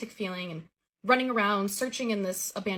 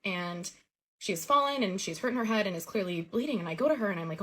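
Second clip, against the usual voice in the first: plain reading voice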